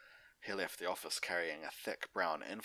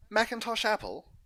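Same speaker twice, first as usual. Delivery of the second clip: higher, louder call of a name; 10 dB louder than usual. Acted. Doctor calling Big Mac from the doorway, loud call.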